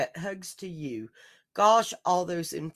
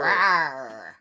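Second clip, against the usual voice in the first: dinosaur voice; 3 semitones lower, 5 dB louder than usual. Acted. Dinosaur roar reply.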